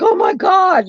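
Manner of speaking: scared squeal. Retold or imitated. Jumping on counter from snake.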